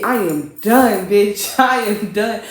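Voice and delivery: loud, fed-up declaration; emphatic, fed up. Retold or imitated. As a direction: announce loudly that you are through. Acts out being finished with him.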